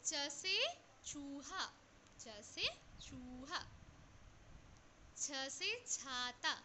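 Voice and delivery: very slow, drawn-out letter chant; slow classroom recitation; slow drill. Shown as a demonstration. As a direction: sound out each word slowly for children. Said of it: "slow letter drill".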